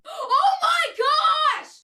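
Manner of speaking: very high, slow gasping shout; stunned outburst; shocked gasp. Real, not acted. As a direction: gasp and cry out in disbelief. Shocked reaction to the quote.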